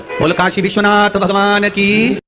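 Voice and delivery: high, drawn-out call to the crowd; sustained congregational acclamation; rallying devotional call. Real, not acted. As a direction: call out the cheer slowly, stretching the last words. Leads the crowd in a cheer.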